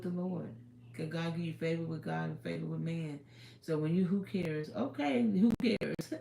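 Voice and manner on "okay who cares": laughing it off slowly. Real, not acted. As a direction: chuckle through it. Laughing aside.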